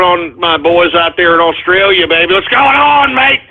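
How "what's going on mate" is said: higher, playful Australian greeting; mock Australian accent. Host greeting his boys in Australia, mock Australian accent. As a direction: hail them in a put-on Australian accent.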